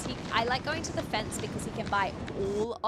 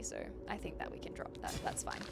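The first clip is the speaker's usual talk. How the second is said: whispered aside, barely voiced; 11 dB quieter, faster than usual. Real, not acted. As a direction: drop to a whisper as if to yourself. Whispered aside while playing.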